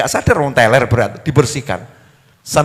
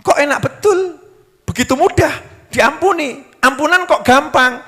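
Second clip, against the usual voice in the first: incredulous doubter; 10 semitones higher than usual. Retold or imitated. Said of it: "Jangan kamu mengatakan".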